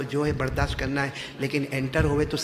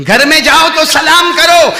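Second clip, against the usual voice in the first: full-force preaching, higher and louder; 12 semitones higher, 18 dB louder than usual. Acted. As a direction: drive the command home to the crowd. Preacher raises voice. (پورے زور سے وعظ)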